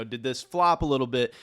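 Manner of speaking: normal talking voice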